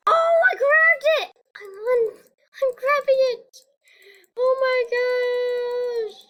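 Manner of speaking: very high, loud thrilled shout; excited shout. Real, not acted. Gamer who just grabbed something shouts in delight. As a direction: yell out in delight. Grabs a thing while flying.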